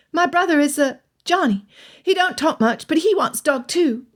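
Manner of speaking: put-on high, eager little boy; young boy voice. A narrator's reading. Young lad to Elizabeth, high eager boy voice. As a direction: play the lad pleading for a dog.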